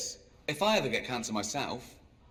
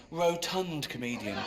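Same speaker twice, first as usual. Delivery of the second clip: usual speaking voice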